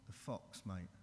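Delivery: ordinary talk, nothing marked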